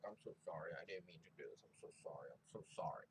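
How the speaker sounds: low, hushed frantic apology; softly muttered mock panic, dropping to a whisper; mock freaking out. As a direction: imitate a panicked apology under your breath. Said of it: "she's all freaking out".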